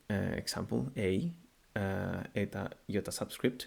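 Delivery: ordinary delivery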